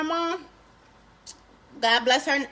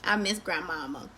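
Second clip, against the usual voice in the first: ordinary delivery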